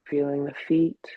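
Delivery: calm, unhurried meditation guidance with long pauses; guided meditation. Acted. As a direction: ease them gently into the body. Leads a meditation.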